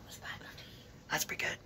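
hushed excited whisper. Real, not acted. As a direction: whisper so nobody hears. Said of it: "I'm sorry I'm whispering".

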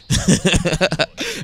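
a high, bright burst of laughter at the joke. Real, not acted. Laughing at a friend's chicken joke, high burst of laughter.

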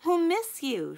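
high, loud shriek. A narrator's reading. Schoolgirl shrieking goodbye to her friend. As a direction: cry it out over the crowd. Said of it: "shrieked Melanie".

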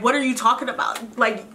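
mock fighting mode. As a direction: push back at God like an annoyed friend, playfully. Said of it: "I might have went into fighting mode".

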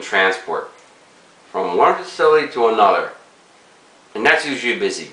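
talking around pipe stem; pipe in mouth. Real, not acted. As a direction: lips clenched on pipe. Seen 0: Pipe held by hand at first, then clenched in mouth from 4.6s.

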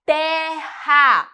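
"terra" in two loud, separate syllables; split into syllables. Shown as a demonstration. Teacher dividing words for class, "terra" loudly split in two. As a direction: break "terra" in two, hitting each part. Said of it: "com a separação silábica gramatical".

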